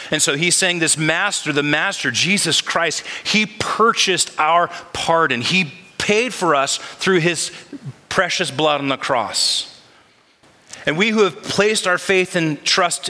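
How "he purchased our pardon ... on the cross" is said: slow, weighty emphasis. Real, not acted. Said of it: "preacher's emphasis".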